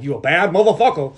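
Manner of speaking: cocky slang praise; swaggering compliment. Retold or imitated. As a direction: say it like tough admiration. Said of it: "bad meaning good".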